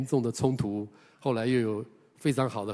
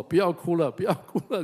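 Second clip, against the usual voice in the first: high, loud pleading to stop crying; half-laughing recalled plea; retold desperate plea; 7 semitones higher, 5 dB louder than usual. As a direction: beg the Lord urgently. Pleading with Jesus on stage.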